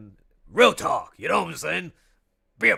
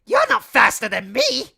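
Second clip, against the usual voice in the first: cocky rival, loud and higher; Jet the Hawk; 7 semitones higher, 11 dB louder than usual. Acted. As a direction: taunt Sonic with a sneer. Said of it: "my Jet the Hawk voice".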